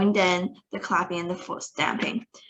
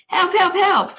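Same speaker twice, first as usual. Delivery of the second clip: shrill panicked yell, much higher and louder; yelling for help; 12 semitones higher, 5 dB louder than usual. A narrator's reading. Teacher on the desk yelling for help, shrill. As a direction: shriek like a frantic teacher.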